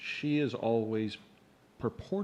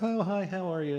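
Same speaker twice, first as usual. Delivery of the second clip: customer service voice; 8 semitones higher than usual. Retold or imitated. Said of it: "what she was talking about".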